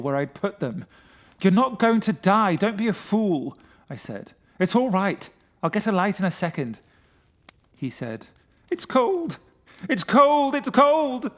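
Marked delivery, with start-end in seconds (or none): 1.4-6.8 loud. A narrator's reading. Narrator shouts to calm friend. 8.7-11.3 high, loud, trembling repetition; terrified moaning. A narrator's reading. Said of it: "Like that, three times".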